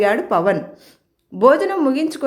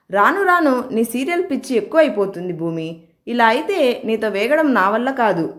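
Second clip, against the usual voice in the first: ordinary delivery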